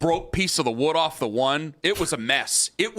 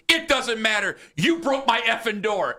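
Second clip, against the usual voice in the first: higher, heated retort; mock outraged clerk; 8 semitones higher than usual. Store clerk scolding the teen over the broken door, high and heated. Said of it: "He goes".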